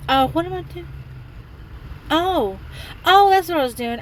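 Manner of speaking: high, sudden realization; catching her own confusion; surprised. Real, not acted. Losing track of thought.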